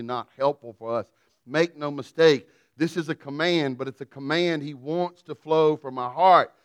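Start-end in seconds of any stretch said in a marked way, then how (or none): 1.5-6.5 preaching exhortation. Acted.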